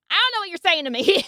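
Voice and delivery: high, loud and fast in disbelief; exasperated. Real, not acted. Friend baffled by the academic jargon, exasperated burst. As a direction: blurt it out, baffled.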